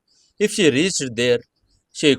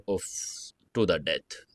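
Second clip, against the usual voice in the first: ordinary delivery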